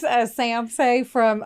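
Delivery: normal talking voice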